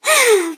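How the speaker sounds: short, high falling sigh; bored boy's sigh. Acted. Boy sulking at the cat, sighing. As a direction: sigh like a bored, hurt kid. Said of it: "and sighed".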